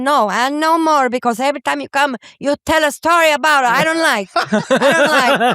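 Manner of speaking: broken-English mother scolding through the door; scolding immigrant mother. Retold or imitated. Mother scolding her son for telling the fart story, mock-indignant. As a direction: play the mother refusing to open up.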